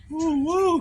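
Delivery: high, loud double "woo"; excited whoop. Real, not acted. Cook showing viewers the finished greens, excited whooping. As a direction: whoop over the finished dish.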